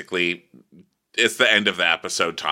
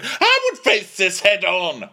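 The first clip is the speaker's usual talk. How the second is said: high, drawn-out parody; mocking quote of Dorit; 11 semitones higher, 3 dB louder, slower than usual. Castmate quoting Dorit back at her, high mocking caricature. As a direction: throw the boast back high and exaggerated.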